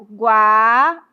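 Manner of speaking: "Guaíba" broken slowly into gua, í, ba; slow, separated "Guaíba" for a class; syllable-by-syllable demonstration. Shown as a demonstration. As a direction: stretch the first syllable of "Guaíba", then pause between syllables. Teacher separates syllables of example word.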